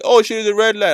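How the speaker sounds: high, loud oh shit; startled realization. Retold or imitated. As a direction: jolt of panic at the red light.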